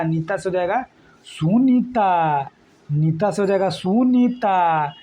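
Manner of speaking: slow, spelled-out classroom recital; teacher repeating the trick slowly; slow drilled mnemonic. Shown as a demonstration. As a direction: draw it out so students remember. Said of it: "teacher drills a memory trick".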